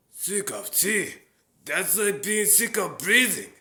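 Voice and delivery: put-on amused old man marvelling at the idea; Uncle Iroh impression. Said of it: "characters like Uncle Iroh".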